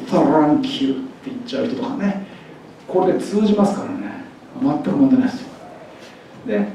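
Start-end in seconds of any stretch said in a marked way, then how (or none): 0.1-0.9 日本語訛りでThankを「サランク」のようにRを入れて引き延ばす; 日本語訛りで余計なRを入れる; 日本語訛りでR入り. Retold or imitated. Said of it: "Rが入ってないのにR入れちゃったりね". (日本語訛りのR入り「サランキュー」)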